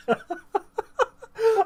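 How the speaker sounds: high, helpless giggle; laughing fit. Real, not acted. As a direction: crack up completely. Laughing at the mutual parody.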